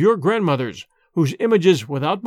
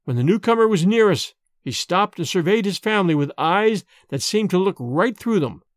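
ordinary delivery

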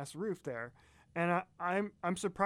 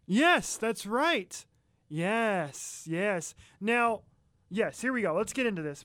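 excited; 4 semitones higher, 5 dB louder, slower than usual. Real, not acted. Excited about band mention.

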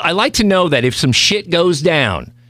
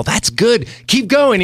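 higher, mock-excited cheering on; excited encouragement; 9 semitones higher, slower than usual. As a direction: retell egging a friend on, pitched up. Retold delighted encouragement.

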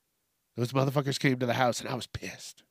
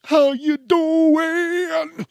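very high, very slow sung-out greeting; drawn-out host croon; stretched greeting; 13 semitones higher, 6 dB louder, much slower than usual. Acted.

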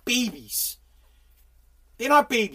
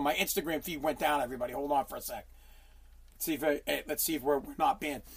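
quiet aside; 9 semitones lower, 11 dB quieter, faster than usual. Real, not acted. Aside to viewers, muttered.

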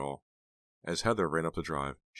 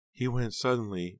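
put-on higher, grief-stricken older relative; crying aunt; 8 semitones higher, 4 dB louder than usual. A narrator's reading. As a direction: voice a bereaved aunt, high and plaintive. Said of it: "wrapping Heather in her arms and crying".

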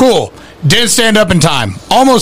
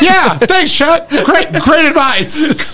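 higher, slow laughing sarcasm; sarcastic thanks; 9 semitones higher, much slower than usual. Retold or imitated. Thanking a fighter for useless advice, higher laughing sarcasm. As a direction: drip mock gratitude through laughter. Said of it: "He's picturing Chuck Liddell's like".

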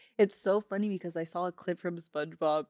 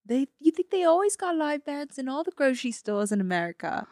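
higher-pitched amateur Aussie; Australian accent; 5 semitones higher, 4 dB louder than usual. Pretend. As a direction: play an Australian tourist, pitched up. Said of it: "we're Australian tourists".

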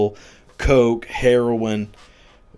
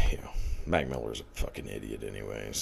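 whispered aside; 10 semitones lower, 13 dB quieter than usual. Real, not acted. Ranting podcaster slips in a jab at a rapper, whispered. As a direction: drop to a soft unvoiced whisper.

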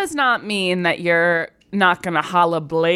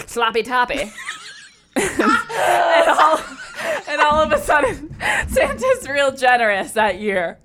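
laughing shout; 6 semitones higher than usual. Real, not acted. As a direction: yell the punchline through laughs. Laughing shout at own joke.

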